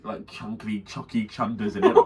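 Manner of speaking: normal talking voice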